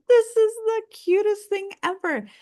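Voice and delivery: very high-pitched adoring squeal of words; gushing. Retold or imitated. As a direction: gush as if over something adorable. Gushing reaction to cute video.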